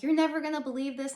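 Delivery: fast, bursting with news and high; excited. Retold or imitated. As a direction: blurt it out, thrilled to tell her. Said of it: "I'm like".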